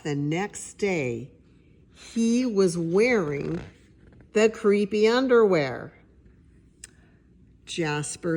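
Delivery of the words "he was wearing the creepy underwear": loud, rising shock reveal; dramatic reveal. A narrator's reading. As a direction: land the twist loud and big.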